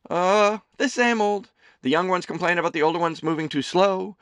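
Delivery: high, loud doting mother voice; a fussing mother teasing her son; Amelie's voice. A narrator's reading. Mother serving her son lunch, high and fussing.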